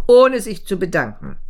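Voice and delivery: usual speaking voice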